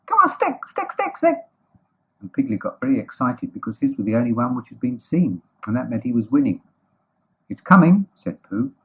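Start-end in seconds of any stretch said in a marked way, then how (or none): 0.0-1.4 very high, squeaky cheering; Roo calling out. A narrator's reading. Roo urging the stick along, high squeaky call. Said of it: "calling out". 7.5-8.1 higher and louder; excited announcement. Acted. Pooh sighting the winning stick, excited call.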